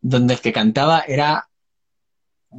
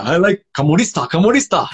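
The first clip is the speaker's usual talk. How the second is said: imitating a manager's Norwegian-accented English; mock Norwegian accent on simple English; 3 semitones higher than usual. Singer quotes the manager who picked him, mock Norwegian accent. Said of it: "con su inglés con acento noruego".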